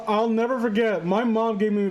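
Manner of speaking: ordinary talk, nothing marked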